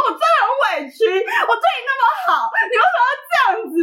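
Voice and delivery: high whiny playing-the-victim plea; fake crying. To her boyfriend mid-quarrel, a hammed-up pitiful whine. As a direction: put on a pitiful fake sob. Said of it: "装可怜就开始哭".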